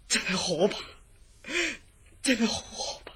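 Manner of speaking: whispered in dread; frightened whisper. Acted. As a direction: breathe it out, still shaken by the dream. Recalling nightmare, shaken. (驚到細聲講)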